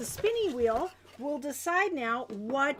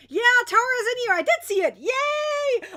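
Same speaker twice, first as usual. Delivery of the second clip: high, loud, delighted shout-out; excited cheer; 8 semitones higher, 8 dB louder than usual. Real, not acted. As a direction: cheer on spotting the winner. Spotting winner in chat.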